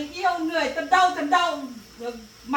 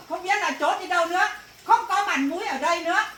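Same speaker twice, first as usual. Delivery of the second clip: ordinary talk, nothing marked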